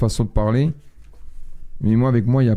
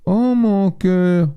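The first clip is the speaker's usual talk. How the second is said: very slow, higher drawn-out coo; mock dog-talk coo; 7 semitones higher, much slower than usual. Man imitates girlfriend greeting the dog, slow mock coo. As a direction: stretch it out like baby talk to a pet. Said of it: "Quand elle voit le chien, elle fait".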